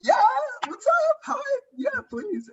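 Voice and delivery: very high, strained, near-crying squeak; mock-pitiful squeak of a sweaty, overloaded guy; exhausted squeaky reply. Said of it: "I'm like".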